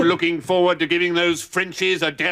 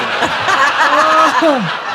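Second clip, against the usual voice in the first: booming guffaw; 8 semitones higher, 8 dB louder than usual. Acted. General laughs at his own joke.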